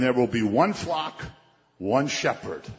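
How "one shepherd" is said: normal talking voice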